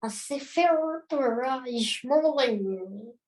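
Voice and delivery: the Hebrew phrase in a slow, mock French accent; a light French accent on the Hebrew blessing. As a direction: say "Sefer Torah Yishmor Aleinu" slowly the way a French speaker would. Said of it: "In a French accent".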